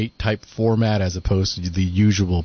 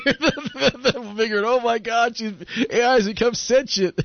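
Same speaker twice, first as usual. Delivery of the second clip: high-pitched, laughing fake panic; mock alarm; 12 semitones higher than usual. Co-host joking that the AI has become sentient, high mock panic. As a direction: squeal it in pretend horror, half laughing.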